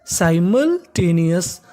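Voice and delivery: Simultaneous drawn out slowly and evenly; slow model word. Shown as a demonstration. Teacher answering his own question slowly for students. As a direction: say simultaneous slowly for the class to repeat. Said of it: "क्या बन गई meaning?".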